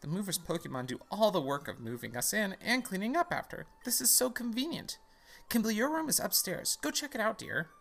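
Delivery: high, lilting mom voice; mom character. Acted. Mom sending Kimblee up to the room, high singsong. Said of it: "Mom says".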